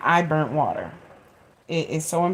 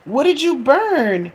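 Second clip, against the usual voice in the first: high, louder startled cry; daughter's alarm; 9 semitones higher, 5 dB louder than usual. Retold or imitated. Daughter coming out of her room, alarmed and loud. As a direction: gasp it out, alarmed at the smell. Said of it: "she was like".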